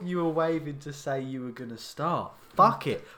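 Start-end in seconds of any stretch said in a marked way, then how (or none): 2.6-3.1 loud frustrated curse. Real, not acted. Frustrated at the botched start.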